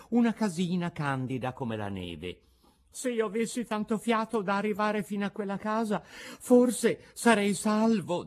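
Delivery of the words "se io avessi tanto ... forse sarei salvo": a panicked puppet hoping aloud; Pinocchio's desperate thought. A narrator's reading. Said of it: "disse dentro di sé". (il pensiero disperato di un burattino)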